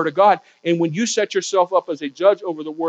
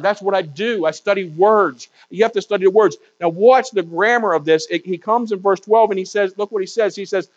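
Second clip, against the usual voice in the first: usual speaking voice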